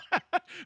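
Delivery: high, bright laugh breaking out; burst of laughter. Real, not acted. Host laughing at his guest's joke, a high burst of laughter.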